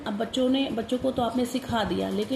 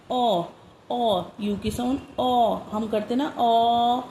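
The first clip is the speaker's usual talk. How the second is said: a loud mock pained ओ; teacher acting out the cry when you get hurt; ouch cry; 4 dB louder, much slower than usual. Shown as a demonstration. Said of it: "ऐसे हमारी चोट लग जाती".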